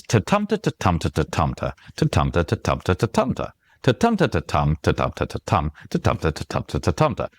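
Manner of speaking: "ta-tumta" lilting, each "tum" stressed, the rest light; limerick beat. Shown as a demonstration. Author explains how limericks go, bouncing beat. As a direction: tap out the rhythm in quick nonsense syllables. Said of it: "the beat is".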